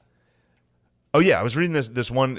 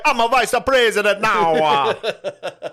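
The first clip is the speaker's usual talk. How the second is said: put-on high, loud laughing Jamaican accent; 10 semitones higher, 7 dB louder, slower than usual. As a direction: crow it in hammy Jamaican patois. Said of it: "do Jamaican accent".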